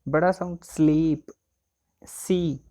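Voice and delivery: स्लीप and सी with a drawn-out long ee; long ee vowel. Shown as a demonstration. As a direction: stretch the ee in स्लीप and सी. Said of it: "काफ़ी long ई है". (स्लीप और सी में लंबा ई खींचकर)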